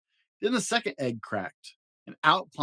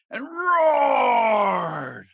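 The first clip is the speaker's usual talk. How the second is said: much higher, loud held roar; 10 semitones higher, 7 dB louder than usual. A narrator's reading. Dad roaring along as the little monsters roar. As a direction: roar the word out.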